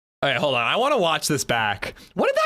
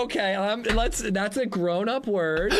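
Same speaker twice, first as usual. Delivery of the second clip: gentle parent voice; 3 semitones higher, 3 dB quieter than usual. Real, not acted. Correcting his baby daughter.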